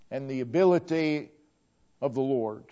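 ordinary talk, nothing marked

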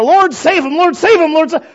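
loud, high pleading prayer; praying aloud for a lost loved one; fervent prayer. Retold or imitated. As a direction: cry out to God for him. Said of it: "person that you've been praying for".

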